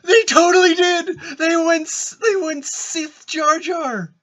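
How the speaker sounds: very high, loud excited squeal; gasp, then squeal it out in disbelief; excited outburst. Real, not acted. Listener reacting to Sith Jar Jar, excited squeal.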